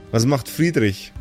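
ordinary delivery

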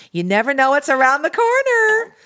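high, loud, sing-out cheeriness; cheery mother imitation. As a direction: mimic a mother's relentlessly upbeat saying. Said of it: "she says it like that".

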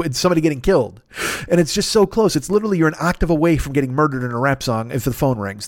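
usual speaking voice

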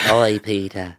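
exaggerated Irish accent. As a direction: put on a thick Irish brogue. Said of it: "that's my Irish accent".

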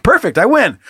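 ordinary talk, nothing marked

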